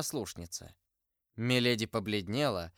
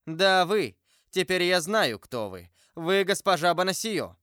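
loud, higher, triumphant accusation; accusing outburst; 6 semitones higher, 6 dB louder than usual. A narrator's reading. Milady unmasking her rival the novice, loud and accusing. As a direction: fling the name at her like a verdict.

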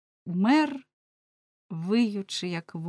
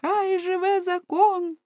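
high, drawn-out acclaiming cry; crowd's cheer; 12 semitones higher, slower than usual. A narrator's reading. People hailing the judge's ruling, cheering. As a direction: call it out high and long like a crowd. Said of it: "гукнув народ".

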